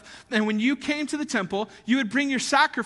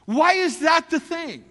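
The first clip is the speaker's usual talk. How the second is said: slow, then why jumps high and loud; mock baffled outburst at God; exasperated question; 5 semitones higher, 5 dB louder, slower than usual. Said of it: "I'm sitting there with you reading this".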